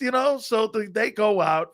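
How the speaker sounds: normal talking voice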